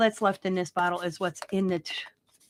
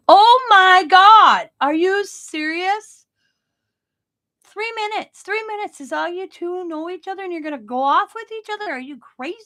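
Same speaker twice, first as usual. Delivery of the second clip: scandalized bartender; 11 semitones higher, 8 dB louder than usual. Retold or imitated. Bartender scolding two strangers leaving together, high and outraged. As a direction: stretch it out, playing the shocked bartender. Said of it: "the bartender's like".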